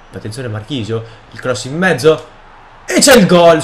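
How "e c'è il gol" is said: high, loud burst of celebration; real gamer's goal cry; goal shout. Real, not acted. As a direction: yell out the goal as it goes in. Calls out a goal scored.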